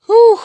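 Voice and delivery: high loud relieved whew; whew of relief. Retold or imitated. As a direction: let out a big relieved whew. Mother relieved at Deku.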